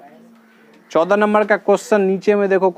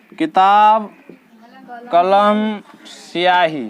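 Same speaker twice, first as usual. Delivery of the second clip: drawn-out, deliberate words; slowly, word by word for pupils; teacher dictating slowly; slow dictation; much slower than usual. Shown as a demonstration.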